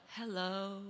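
imitating a soft, gentle greeting; a hint of a shy teenager saying hello. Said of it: "He is too soft".

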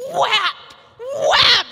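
loud, high slapping whap noises; sharp imitated whack sounds; mimicked banging. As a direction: smack out the sound of a dowel hitting the wall. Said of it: "I hear this, like".

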